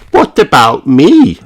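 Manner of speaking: put-on high, plaintive little girl voice; a small pig feeling left out; Peppa. A narrator's reading. Peppa asking Daddy Pig to carry her, plaintive little voice. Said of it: "says Peppa".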